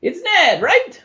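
high showman voice, rising question; playful stage performer asking; jovial performer. Acted. DM voices Octavio in character.

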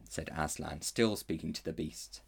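plain reading voice

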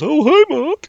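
high, loud mock Wiseau greeting; Tommy Wiseau impression. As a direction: squeak it like the famous meme. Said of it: "the guy on the podcast that goes".

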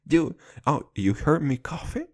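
normal talking voice

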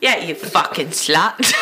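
slow, laughing fake British insult; a playful British-accent jab, drawn out; mock British accent. Teasing her friend in a put-on British accent. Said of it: "Mean things sound better in a British accent".

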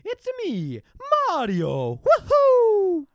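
high, loud video-game hero catchphrase; Mario. Acted. Host shows off a game impression, high and loud. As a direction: whoop it out high and big. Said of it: "I got a good one".

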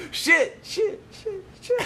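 high, loud repeated curse through laughter; echoing curses. Retold or imitated. Grandmother's curse echoing in the cathedral, high and laughing. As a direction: mimic the echo, laughing. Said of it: "my grandmother goes".

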